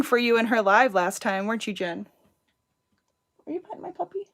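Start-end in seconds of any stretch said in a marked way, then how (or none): 3.5-4.3 pet talk. Real, not acted. Talking to the dog.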